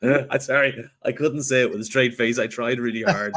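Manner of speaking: loud apology through real laughter; cracking up. Real, not acted. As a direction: still laughing as you get the words out. Apologising after cracking up at own joke.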